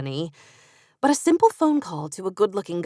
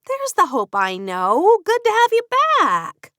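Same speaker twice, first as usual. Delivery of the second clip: Katie voice; 12 semitones higher, 6 dB louder than usual. Acted. Katie teasing Hope.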